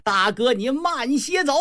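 high, theatrical call after the dead; grieving cry. Acted. Second brother calls to slain eldest, high anguished cry. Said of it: "大喊一声".

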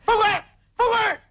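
high-pitched urgent yell; calling after him. Acted. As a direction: yell after someone who is leaving. Calling after the fleeing partner.

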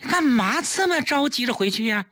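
high-pitched, baffled question; bewildered, voice lifted high; puzzled. A narrator's reading. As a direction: ask why the sudden hurry. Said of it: "季嫣然不解其意".

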